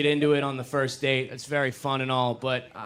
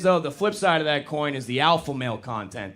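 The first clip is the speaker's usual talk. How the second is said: ordinary talk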